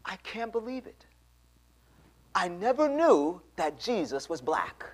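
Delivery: high-pitched retold disbelief; astonished. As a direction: marvel at it as the amazed woman. Woman's astonished realisation.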